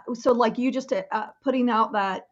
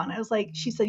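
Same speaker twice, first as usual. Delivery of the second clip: ordinary delivery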